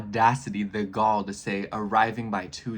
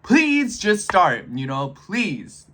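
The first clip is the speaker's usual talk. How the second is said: higher, louder, slow drawn-out begging; hammed-up pleading, slow and loud; desperate plea; 7 semitones higher, 7 dB louder, much slower than usual. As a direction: stretch each word out like begging the universe. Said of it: "I'm like".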